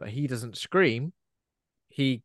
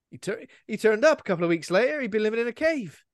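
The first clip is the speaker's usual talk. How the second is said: high, loud hammed-up panic; mock dismay; 10 semitones higher, 5 dB louder than usual. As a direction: ham up the horrified realisation. Mock retelling of horror cliché.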